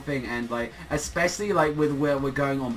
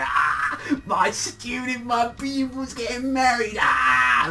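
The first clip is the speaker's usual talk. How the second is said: high, drawn-out mock wail of joy; hammed-up high squeal ending in a shout; overjoyed master; 11 semitones higher, 5 dB louder, slower than usual. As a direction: crow with overblown pride, stretching the words. Acted excited master grabbing his pupil.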